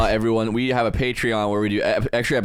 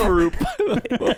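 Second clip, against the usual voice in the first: laughing; 12 semitones higher, slower than usual. Real, not acted. Joke phrase repeated laughing.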